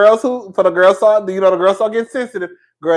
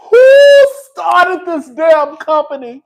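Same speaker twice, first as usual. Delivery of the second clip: high, loud, slow yell; Bernie furious shout; 10 semitones higher, 8 dB louder, much slower than usual. Retold or imitated. Wife yelling at husband about company, loud and high. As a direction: bellow it, every word furious. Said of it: "She was pissed".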